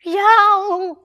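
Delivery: very high, loud yelp; a shrill panicked dog yelp. A narrator's reading. Scruff fleeing the cog spell, very high loud yelp. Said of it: "went Scruff".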